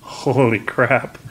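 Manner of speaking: ordinary delivery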